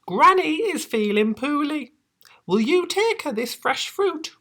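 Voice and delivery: high, bright mother voice; sweet high mum asking a favour; mother's voice. Acted. Mother voice continues.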